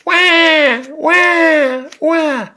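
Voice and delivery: put-on high loud baby cry; baby wail. Baby waking up wailing, high and loud.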